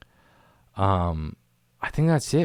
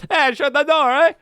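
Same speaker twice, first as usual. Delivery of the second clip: high, loud call to close up; mock shout, pitched way up; imitated yell; 7 dB louder than usual. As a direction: call it out loud, mimicking him. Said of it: "and then he goes".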